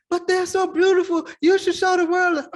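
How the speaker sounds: high, loud tearful plea; imitated crying. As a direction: plead at a wail, imitating someone in tears. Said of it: "she's crying".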